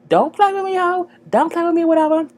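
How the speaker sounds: high, loud don't-play-with-me sass; sassy high warning. Pretend. Egging Charlie on against her rival, high loud sassy warning. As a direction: pitch it up and snap it out. Cheering Charlie on, mock threat.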